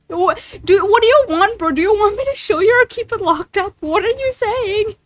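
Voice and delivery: high-pitched exasperated questions; flustered. Real, not acted. Streamer confused by chat's contradictory requests, flustered.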